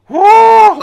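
very high, loud "woo"; loud whoop. Real, not acted. Player reacting to a close moment, loud whoop. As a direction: let out a piercing whoop. Cries out during play.

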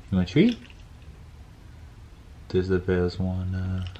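ordinary talk, nothing marked

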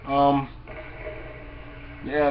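ordinary talk, nothing marked

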